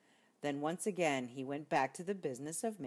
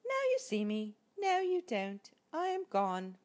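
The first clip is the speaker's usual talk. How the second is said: higher lightly voiced mouse; playful mouse; 9 semitones higher, 4 dB louder than usual. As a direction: tease it lightly. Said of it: "murmured the mouse".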